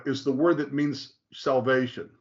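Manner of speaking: ordinary talk, nothing marked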